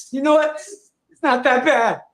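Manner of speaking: self pep talk. Retold or imitated. Pepping himself up mid-jog.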